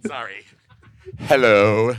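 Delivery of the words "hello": low, very loud hello; booming greeting. Real, not acted. Guest told to use the mic, low booming hello. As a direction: boom it straight into the mic.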